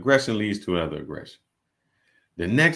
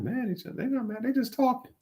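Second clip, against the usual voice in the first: high, softer mock disbelief; incredulous retort; 13 semitones higher, 7 dB quieter, faster than usual. Brother explains his sisters to onlookers, mock-incredulous. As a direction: replay a baffled denial, pitched way up.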